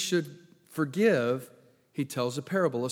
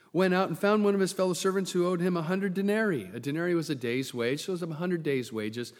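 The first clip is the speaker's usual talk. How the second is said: usual speaking voice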